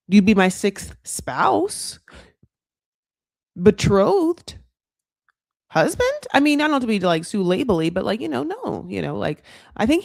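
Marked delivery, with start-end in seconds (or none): 0.1-6.3 mock hesitant fumbling for a word; searching for the word. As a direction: fumble for the right title. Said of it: "Not true".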